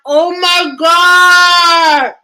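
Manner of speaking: drawn-out, loud, high "oh my God"; real amazement, stretched out and shouted; shocked outcry. Real, not acted. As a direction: cry out in stunned disbelief. Reacts to the passage.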